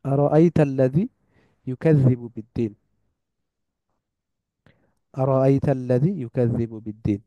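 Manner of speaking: teacher repeating a verse for learners; Quran recitation. Acted. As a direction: recite it patiently, over and over.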